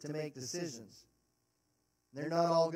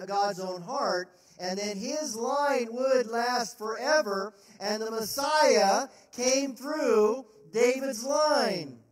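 rising louder and slower to a ringing close; preacher swelling to full force; building preaching climax; 3 semitones higher, 5 dB louder than usual. Real, not acted. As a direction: build the promise up to a big slow finish.

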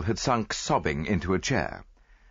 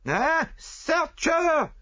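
screaming; 4 dB louder, much slower than usual. A narrator's reading. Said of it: "screamed the other".

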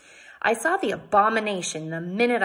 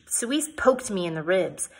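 plain reading voice

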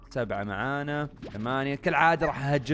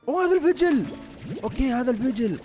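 high and loud with delight; thrilled gamer spotting the radish; excited; 10 semitones higher, 4 dB louder than usual. Real, not acted. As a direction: squeal it out at the find.

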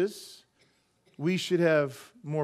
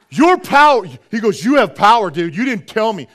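much louder, higher stunned exclamations; amazed outburst; 4 semitones higher, 12 dB louder than usual. Retold or imitated. The spiritist amazed at the pastor's power, loud high exclamations. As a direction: blurt it out in disbelief. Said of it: "And he goes".

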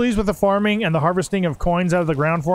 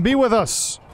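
ordinary talk

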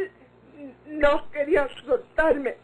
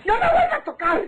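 grito de rechazo; 9 semitones higher, faster than usual. Acted. Victoria rechaza al viejo gritando.

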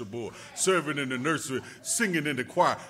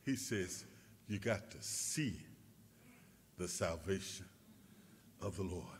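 much softer, low sermon voice; hushed preaching; 7 semitones lower, 11 dB quieter than usual. Acted. Preacher quieting the church to be still, hushed. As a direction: pull them in close and quiet.

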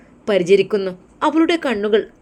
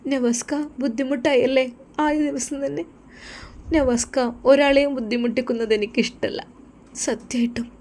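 put-on higher voice for the shy young wife; 6 semitones higher than usual. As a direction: lift the pitch, a gentle bride. Wife voiced by male narrator.

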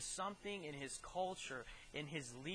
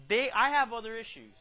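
mock retort; 5 semitones higher, 13 dB louder than usual. Preacher mocking accusers, loud.

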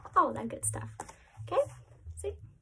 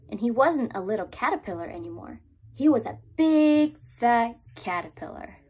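lively storybook read-aloud with rising hungry refrains; reading to children; 4 dB louder than usual. Acted. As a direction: read it like story time for little ones. Reads picture book to class.

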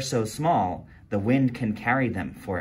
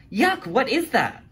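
put-on disgusted kid; 11 semitones higher, 4 dB louder than usual. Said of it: "Wanda asked".

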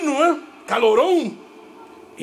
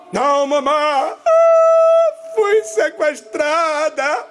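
higher, drawn-out hammed-up whine; mock pleading; 5 semitones higher, 4 dB louder than usual. Sister excusing herself to her mother, mock-pitiful whine. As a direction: imitate a girl pleading her invented excuse.

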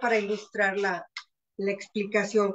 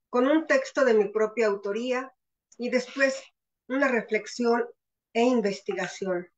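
ordinary talk

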